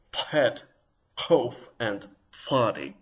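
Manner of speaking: "Pet, kof, and tzadi" from the back of the throat; Arabic-style emphatics. Shown as a demonstration. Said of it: "in the back of my throat".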